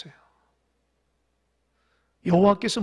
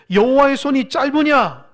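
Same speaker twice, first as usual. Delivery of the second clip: loud, raised challenge for others to repeat; emphatic repeat; 6 semitones higher, 9 dB louder than usual. Shown as a demonstration. Preacher has congregation repeat God's question, loud and emphatic. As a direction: belt out the scripture question forcefully. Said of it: "한번 따라해 보세요".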